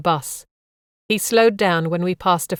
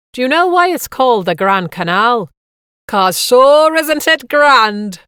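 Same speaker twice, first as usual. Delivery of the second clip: Irish punchline; 5 semitones higher, 7 dB louder than usual. A narrator's reading. Guide delivering his canal pun to the bus, broad Irish accent. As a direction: stretch the last line in broad Irish for the laugh.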